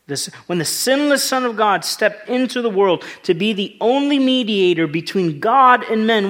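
fervent, high, forceful pulpit oratory; impassioned preaching. Real, not acted. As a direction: preach it at full force, hammering each charge.

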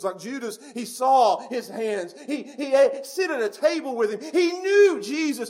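slow, loud, rising preacher's cry; full-force preaching. Acted. Preacher to the congregation about Judas, full-force preaching.

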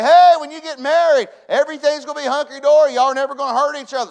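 high, shouted rosy assurance; mock promise. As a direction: shout it like too-good-to-be-true news. Preacher mocks a false marriage promise.

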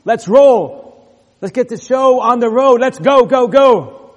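building to a loud, high shout; rallying cry. Acted. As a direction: fire them up, let's go. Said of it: "So he's saying".

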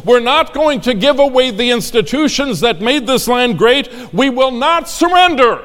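loud, high rallying cry; roaring rallying speech. Acted. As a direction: build to a roaring climax. Peak of rally.

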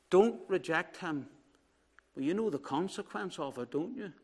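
preacher softly pleading with the congregation; hushed appeal. Real, not acted. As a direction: lean in and plead gently. Quiet closing appeal in church.

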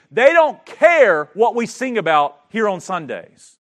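thundering revival sermon; full-force preaching. Acted. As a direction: preach it out to the back row.

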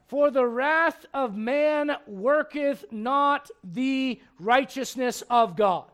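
very slow, deliberate reading; solemn, drawn-out scripture. Shown as a demonstration. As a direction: lay out each word of the verse.

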